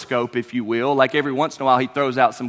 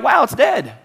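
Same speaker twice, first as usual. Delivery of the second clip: high, loud mock amazement; amazed exclamation; 8 semitones higher, 5 dB louder than usual. Disciples finding the tree dead, amazed. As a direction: exclaim it like astonished onlookers.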